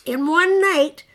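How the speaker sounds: hammed-up monster warning, swelling at the end; warned. A narrator's reading. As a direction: build to an ominous monster outburst. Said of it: "he warned".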